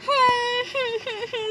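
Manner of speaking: high wailing sob; child's sob; child crying. Acted. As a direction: cry out like a hurt little girl. Child cries after toy broke.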